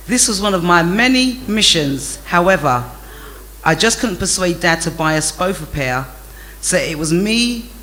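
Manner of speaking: usual speaking voice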